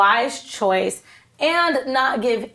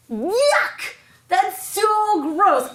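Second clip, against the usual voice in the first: high, laughing yuck, then a slow drawn-out gross; disgusted yuck; 9 semitones higher, much slower than usual. Real, not acted. Disgust at drinking gold water.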